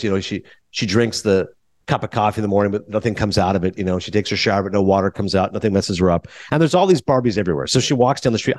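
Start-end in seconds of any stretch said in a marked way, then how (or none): none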